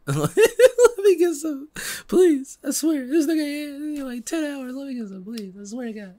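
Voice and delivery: hammed-up sobbing plea for weed; whiny begging. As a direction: beg like a desperate kid at the window. Begging at the weed shop window.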